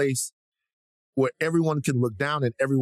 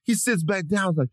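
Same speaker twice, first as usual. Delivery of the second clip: ordinary talk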